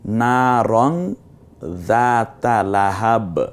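slow recitation ending in a stopped লাহাব; slow recited যাতা লাহাব; Quran recitation. Shown as a demonstration. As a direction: chant it slowly and stop dead on the b. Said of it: "শুধু লাহাব বলতে হয়".

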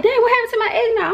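high, loud imitation of grandma; mimicking grandma. Grandma discovering her eggnog gone, high and loud. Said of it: "she like".

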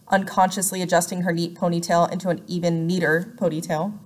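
plain reading voice

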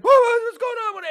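high, loud, slow panicked cry; a terrified man caught off guard; scared man. Retold or imitated. S1 voicing the scared exterminator, panicked. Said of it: "the guy's like".

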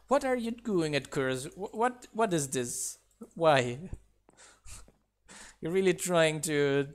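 ordinary delivery

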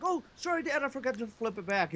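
put-on small, high, soft child's reply; apologetic kid. Kid owning up to screaming dad, meek and apologetic.

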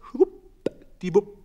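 high, slow, drawn-out schwuppdiwupp; playful high schwuppdiwupp; sing-song schwuppdiwupp. A narrator's reading. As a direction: swoop the word up high and stretch it. Hens hoisted up on line.

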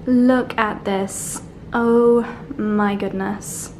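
slow, drawn-out, hushed wonder; slowly marvelling at the sunset; slow awe. Real, not acted. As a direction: savour each word in awe. Same words said again.

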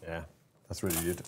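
ordinary talk, nothing marked

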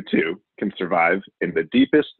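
usual speaking voice

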